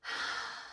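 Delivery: soft breathy sigh before answering; sigh, searching for words; heavy sigh. Acted. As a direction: let out a quiet sigh. Pausing before a difficult answer.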